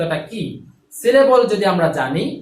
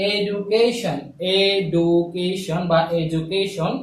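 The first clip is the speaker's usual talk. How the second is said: Education stretched out slowly, each syllable split apart; teacher slowly breaking education into beats; much slower than usual. Shown as a demonstration. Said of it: "চারটা অংশ পেয়েছি আমরা".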